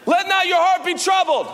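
high, loud declaration of the verse; loud proclaimed verse; proclaiming loudly. Retold or imitated. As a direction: ring it out like a command of comfort. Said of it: "Jesus quoted again".

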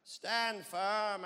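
put-on Indian accent as prophet; Indian-accented Moses. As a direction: declaim it with a mock Indian lilt. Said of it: "Apparently Moses was Indian".

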